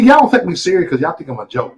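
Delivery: ordinary talk, nothing marked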